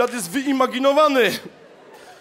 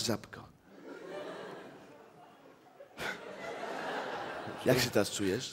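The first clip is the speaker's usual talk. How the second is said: low seductive; 10 semitones lower, 15 dB quieter than usual. Acted. Flirting with client.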